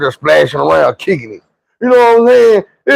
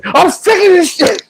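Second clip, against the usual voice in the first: mama's outburst. Retold or imitated.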